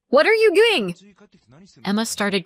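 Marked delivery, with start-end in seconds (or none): 0.1-0.9 very high, loud cry; alarmed shout. Acted. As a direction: shriek in disbelief. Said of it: "my mom shouted".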